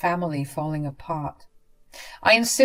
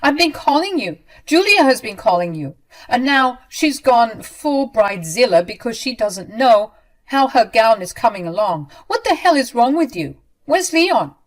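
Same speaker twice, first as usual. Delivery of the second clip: loud, high furious rant; 6 semitones higher, 8 dB louder than usual. Acted. Mother-in-law berating her, loud and furious. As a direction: tear into her at full volume. Ramona ranting at narrator.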